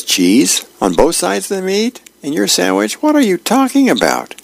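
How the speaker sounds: rising into shocked indignation; indignant wife. Acted.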